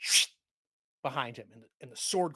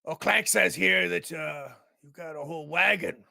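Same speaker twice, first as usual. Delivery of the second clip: orc captain; 3 semitones higher, 8 dB louder than usual. Acted. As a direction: draw it out, loud and probing. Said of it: "looks over and he goes".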